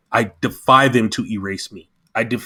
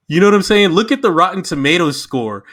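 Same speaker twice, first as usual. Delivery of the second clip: ordinary talk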